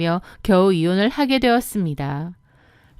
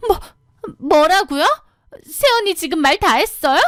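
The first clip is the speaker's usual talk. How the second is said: put-on higher, petulant young woman's voice; sister-in-law; 8 semitones higher, 3 dB louder than usual. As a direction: whine at her to come fetch you. (높은 목소리로 투덜대는 시누이)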